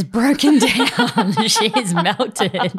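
giggling through the words; laughing. Real, not acted. Laughing about her crying.

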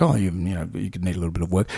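low, quiet put-on verdict; Alan Jones. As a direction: drop low and soft, imitating a dismissive broadcaster. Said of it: "Alan Jones was like".

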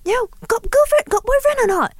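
imitating an eager auntie matchmaking, high and bright; Singaporean auntie. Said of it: "told my friends".

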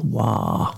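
low, drawn-out "wah"; emphasised syllable. Shown as a demonstration. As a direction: echo "wah" low and long, as the stressed syllable. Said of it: "He puts the emphasis on the last syllable".

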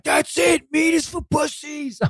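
high voice, the brute gets it; mock dumb epiphany. The weightlifter, won over, repeats the slogan in a high mocking voice.

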